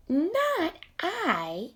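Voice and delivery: a vain cat waving off the request; vain cat refusing. Acted.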